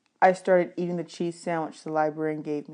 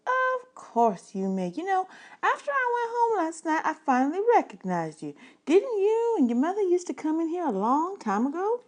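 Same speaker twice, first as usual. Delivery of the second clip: librarian voice; 12 semitones higher than usual. Acted. Librarian telling the boy she recognized him, higher lady's voice. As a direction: play the friendly librarian recalling him.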